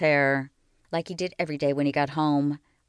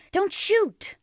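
high, frantic shout to stop the gun; boy yelling at a man not to shoot; yelled plea. A narrator's reading. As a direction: cry out so he won't shoot. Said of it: "Little Willie yelled".

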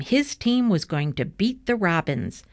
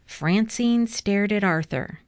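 plain reading voice

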